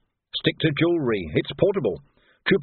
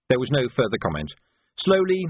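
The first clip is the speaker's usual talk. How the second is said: plain reading voice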